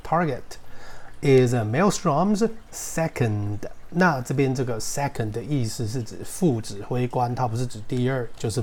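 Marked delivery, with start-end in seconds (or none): 0.1-3.6 target said slowly and carefully; slow correct target. Shown as a demonstration. Teacher correcting how students say target, slowly. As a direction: stretch out target, then finish the phrase slowly. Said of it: "它不念target，它念target".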